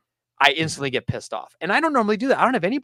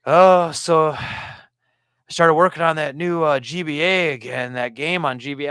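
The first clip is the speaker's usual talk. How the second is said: slow, drawn-out mock customer voice; a hint of a rambling customer; imitating chatty customer; slower than usual. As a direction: drag the words like an oblivious talker. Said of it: "he'll be like".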